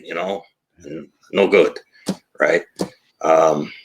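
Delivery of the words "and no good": put-on hammy Italian accent, overbearing boss; mock Italian accent, lightly done; bad Italian accent. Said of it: "my bad Italian accent".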